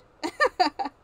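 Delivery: high, loud burst of laughing; real, sudden laugh out loud; burst of laughter. Real, not acted. Writer amused while drafting live, loud high laugh.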